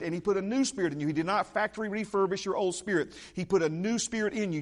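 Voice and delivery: ordinary delivery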